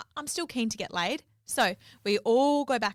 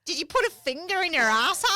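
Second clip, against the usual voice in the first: put-on high, loud drunken blurt; drunk friend's blunt question; 8 semitones higher, 5 dB louder than usual. Drunk friend barging in, quoted.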